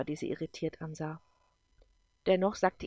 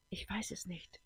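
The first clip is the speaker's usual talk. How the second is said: hushed, much softer; whisper; 11 dB quieter than usual. A narrator's reading. Eva answers Gordon once focused again, whispering. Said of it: "flüsterte sie".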